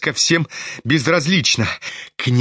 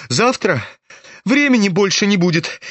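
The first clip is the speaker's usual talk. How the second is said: high-pitched, agitated retort; a touch of hysteria in a raised pitch; mildly hysterical; 6 semitones higher than usual. A narrator's reading. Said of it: "истерически усмехнулся Ипполит". (с лёгкой истерикой, высоким голосом)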